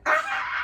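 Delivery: high-pitched "ah" scream, held back in volume; re-enacted scream. Mother starting a group scream with her kids, mock scream. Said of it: "let's scream as loud as we can".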